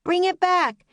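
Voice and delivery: high call across the yard. A narrator's reading. Annie calling to the puppy across the yard. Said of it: "Annie called".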